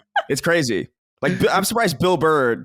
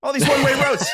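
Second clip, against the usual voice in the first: put-on angry Boston accent, pitched much higher; Bill Burr impression; 12 semitones higher, faster than usual. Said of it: "that was pretty good, [name]".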